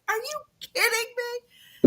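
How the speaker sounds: high incredulous are you kidding me; mock outrage; disbelief. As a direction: stare at her mom in disbelief. Looking at her mom.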